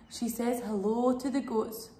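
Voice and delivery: ordinary delivery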